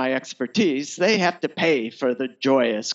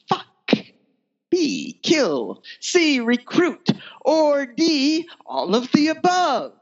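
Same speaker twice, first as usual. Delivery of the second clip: giddy options list; 10 semitones higher, slower than usual. A narrator's reading. Narrator listing her options, gleeful and rising. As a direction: tick off the choices with growing relish.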